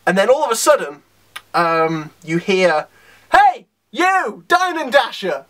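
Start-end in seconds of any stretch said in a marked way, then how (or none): 3.3-5.4 high, drawn-out holler; mock heroic call-out. Hero hailing a stranger all of a sudden, mock heroic call-out. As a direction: call out suddenly, pitched up, punching the first word.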